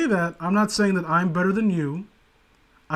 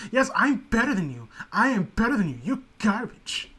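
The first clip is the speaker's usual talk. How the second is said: higher, snooty mock voice; cartoon character impression; 4 semitones higher than usual. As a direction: sneer down like a cartoon villainess. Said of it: "what's her name from the Young Turtles".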